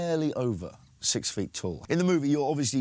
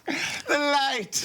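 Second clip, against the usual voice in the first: high, hammed-up yelp at the light; mock dazzled cry; 13 semitones higher, much slower than usual. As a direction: ham it up like the light burns. Mocking actor dazzled by sunlight.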